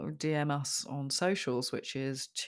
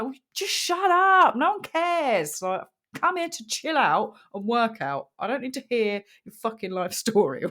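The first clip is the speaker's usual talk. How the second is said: exasperated retort; 8 semitones higher, 7 dB louder than usual. Retold or imitated. Fed-up yoga-goer telling off a chatterbox, exasperated. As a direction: stretch it out in hammed-up exasperation.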